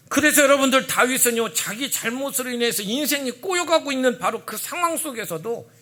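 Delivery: loud, high preaching build; fervent pulpit voice; forceful preaching. Real, not acted. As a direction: preach it out to the congregation.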